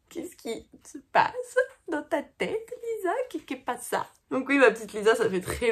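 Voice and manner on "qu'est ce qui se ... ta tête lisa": fond disbelief. Real, not acted. Fond incredulous question.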